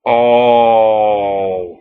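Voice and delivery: low, loud drawn-out oh of realisation; dawning dread. Acted. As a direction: sound the penny dropping. Joker sees weather vane.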